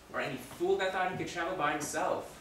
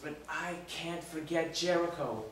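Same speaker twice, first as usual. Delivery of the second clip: ordinary talk, nothing marked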